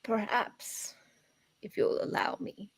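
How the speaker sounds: put-on soft, whispered wise old woman; old lady voice. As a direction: hush it like a mystic crone. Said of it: "my old lady voice".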